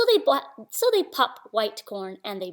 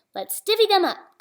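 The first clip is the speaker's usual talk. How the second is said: higher, louder cheerful offer; badger voice; 9 semitones higher, 5 dB louder than usual. Badger sharing honey nuts, lightly voiced and cheerful.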